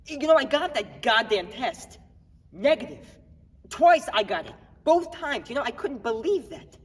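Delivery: acted incredulous outburst, punching the short phrases; agitated disbelief. Man telling cops about his negative test, agitated.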